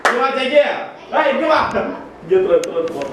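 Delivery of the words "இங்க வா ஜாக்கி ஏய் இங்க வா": high calling out to the dog; calling from far away. Real, not acted. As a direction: call the dog back from afar.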